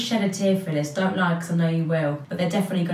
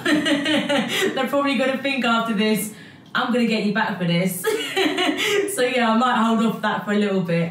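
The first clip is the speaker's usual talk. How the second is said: higher, amused, breaking into laughter; laughing; 4 semitones higher than usual. Real, not acted. Sister joking about her brothers' payback, laughing. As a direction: talk through real giggles.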